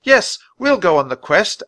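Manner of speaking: put-on high, loud, determined girl's yell; volunteer loudly and eagerly; excited high-pitched shout. A narrator's reading. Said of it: "Annie yelled".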